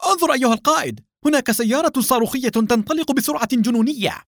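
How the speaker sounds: very high-pitched cry of alarm; urgent shout. A narrator's reading. Freed psychiatrist alerting his captain to a speeding car, urgent shout. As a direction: call out excitedly to alert him. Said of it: "صاح رمزي".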